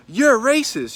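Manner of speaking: high, loud mock accusation; imitated yelling. Girl yelling at the cashier behind the counter, imitated shout. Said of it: "She started yelling at the girl".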